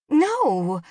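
high, loud, indignant no; shocked mother's protest. A narrator's reading. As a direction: deny it in shock. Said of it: "Jen was shocked".

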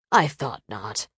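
very low, soft and smug; lazy arrogant nobleman. A narrator's reading. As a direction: let it drop low with quiet satisfaction. Vampire captor speaks.

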